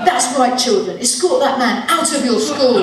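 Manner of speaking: put-on loud, slow headmaster call to children; mock school announcement. Comic booming at schoolchildren in a loud slow headmaster voice. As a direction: boom it out like a headmaster warning pupils.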